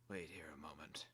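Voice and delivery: a stern guard whispering; whispered order. Acted. Guard speaks before door.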